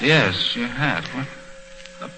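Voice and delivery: flustered trailing off. Acted. Flustered husband repeats boss.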